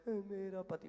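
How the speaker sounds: mock-crying wife's voice; imitated tearful wail. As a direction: imitate her sobbing through the words. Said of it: "तो वो रोते रोते बोली".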